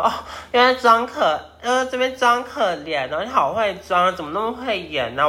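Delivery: a retold mocking jab; sarcastic mock. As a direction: needle her for faking pity. Mocking boss who plays pitiful.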